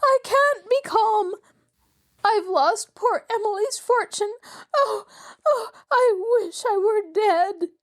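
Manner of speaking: sobbing despair. A narrator's reading. Mrs Willoughby to the manager, sobbing in despair. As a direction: break down in tears. Lady breaks down over lost fortune.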